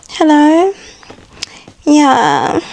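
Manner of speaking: high, put-on dainty phone-style greeting; sweet new-girlfriend voice. Woman talking to her new man, put-on sweet. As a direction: play up a coy, girly voice. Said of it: "I was speaking to him like this".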